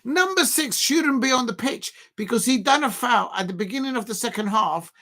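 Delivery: ordinary talk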